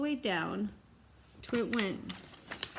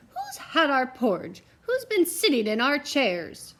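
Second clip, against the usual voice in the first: louder, slower indignant bear cry; 5 semitones higher, 7 dB louder than usual. Acted. The bears find their porridge eaten, indignant slow cry. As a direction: demand it like offended bears. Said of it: "three indignant bears who cried".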